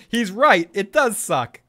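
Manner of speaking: loud, high emphatic agreement; quoted agreement. Acted. Host voicing a chatter siding with Joe, emphatic. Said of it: "And Omen says".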